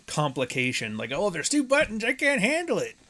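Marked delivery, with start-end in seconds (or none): 1.1-3.0 put-on high whiny old guy overwhelmed by tech; flustered boomer. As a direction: squeak it out like a helpless boomer. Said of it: "his boomer self doesn't like complication".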